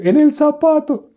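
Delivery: slow, very high mock sobbing; la Chilindrina crying. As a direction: wail out the answer in comic tears. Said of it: "Y ella llorando". (lloriqueo chillón y fingido)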